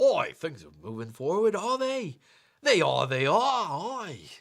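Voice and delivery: mock working-class English with swinging pitch. Shown as a demonstration. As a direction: demo a rough English street accent. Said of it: "lower class English".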